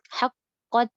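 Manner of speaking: haqqat with the qaf merged, no bounce; correct haqqat. Shown as a demonstration. Teacher demonstrating the correct haqqat. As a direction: say haqqat as one clean doubled qaf. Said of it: "bukan haqqat".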